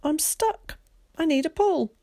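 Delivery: put-on high-pitched boy stuck and pleading; exasperated Zack. A narrator's reading. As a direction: call for help in a high boy's voice.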